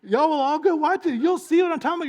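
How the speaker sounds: high loud hammed-up protest; upset outburst. Scott demanding the family turn off the nature show, loud and high. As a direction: yell to kill the show. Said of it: "Scott's like".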